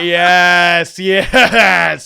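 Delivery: mock gleeful yes through a laugh. Streamer recalling his reaction, laughing with glee. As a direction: chuckle out a delighted yes. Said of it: "I was laughing".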